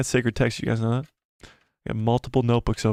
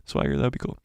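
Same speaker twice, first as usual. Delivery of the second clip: low cool guy voice; deep put-on voice; 10 semitones lower, 4 dB quieter, faster than usual.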